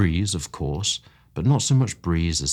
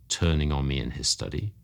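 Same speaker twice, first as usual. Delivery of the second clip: ordinary delivery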